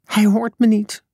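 high, mildly distressed cry; understated tearful wail; narrated crying. A narrator's reading. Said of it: "Zaza huilde".

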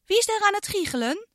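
put-on high, fast child's question; a girl demanding who is there; girl Iris alarmed. Girl hears giggling, high fast alarmed question. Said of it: "vroeg Iris".

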